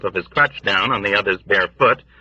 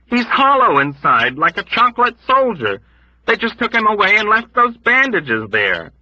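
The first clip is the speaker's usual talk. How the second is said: high, loud agitated shout; loud raving shout; 12 semitones higher, 4 dB louder than usual. Acted. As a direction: shout the explanation wildly. Dunbar shouting explanation.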